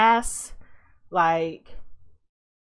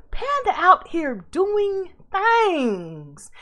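movie-quote voice; 7 semitones higher, much slower than usual. Retold or imitated. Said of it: "Points if you know where that's from".

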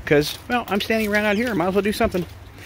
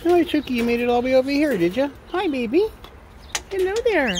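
pet-talk voice; 10 semitones higher than usual. Real, not acted. Owner greeting Chucky who came over, high pet talk. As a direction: pipe it up high for a little animal.

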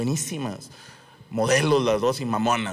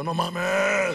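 drawn-out, higher groan of dismay; dismayed realization; 4 semitones higher than usual. Retold or imitated. Comedian realizing the star heard his joke, dismayed. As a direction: let the horror sink in, voice raised.

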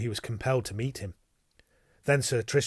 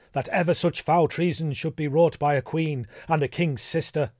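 raised voice, mildly outraged; indignant cry; 5 semitones higher, 5 dB louder than usual. A narrator's reading. Gawain condemns the queen's foul treason, crying out indignantly. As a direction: declaim it with indignation. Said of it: "cried Sir Gawain".

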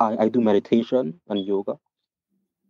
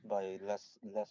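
barely audible; 17 dB quieter, much slower than usual. Real, not acted. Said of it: "we can barely hear you".

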